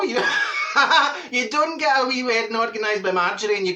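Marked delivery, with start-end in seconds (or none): 0.3-1.3 laughing. Real, not acted. Laughs at her own story.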